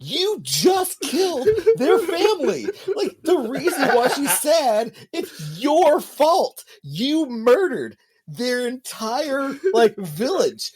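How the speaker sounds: incredulous laughing outrage; laughing outraged rant. Real, not acted.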